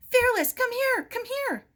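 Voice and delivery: very high, loud urgent call; high desperate call while running. Acted. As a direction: call him back before the tide. Running as tide comes in.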